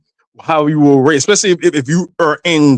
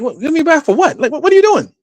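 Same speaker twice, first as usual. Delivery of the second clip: high mock-shocked questions; incredulous protest; 12 semitones higher, much faster than usual. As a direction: protest in disbelief. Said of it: "I'm like".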